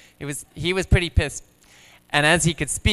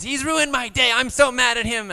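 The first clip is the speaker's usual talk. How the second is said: put-on much higher child voice, indignant; imitating mad son; 11 semitones higher than usual. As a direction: squeak it out like a furious little kid. Quoting angry son.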